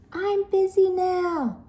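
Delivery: lazy sister shouting; high shout. Acted. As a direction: shout back impatiently. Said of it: "shouted Hilda".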